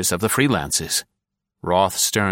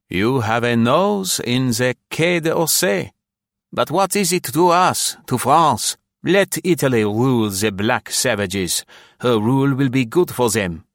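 haughty Frenchman; 5 semitones higher, 3 dB louder than usual. Acted. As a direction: sniff it off as beneath concern. Second Frenchman's dismissive reply.

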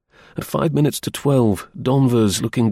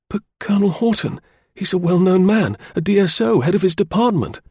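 high-pitched, astonished protest; incredulous; 10 semitones higher, 3 dB louder than usual. A narrator's reading. Danvers doubting the news about the Colonel, incredulous. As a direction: protest in disbelief.

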